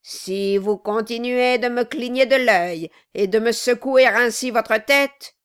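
loud menacing warning; threatening dwarf. Acted. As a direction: threaten him. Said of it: "dit le nain".

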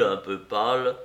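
ordinary delivery, nothing marked

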